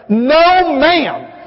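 put-on toddler's yell. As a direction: shout it like a bossy little girl. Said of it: "she just looked at him and yelled".

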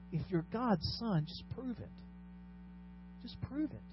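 hushed, lightly acted goading; tempter's dare. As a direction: softly dare him, acting the tempter. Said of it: "what Satan is saying to him".

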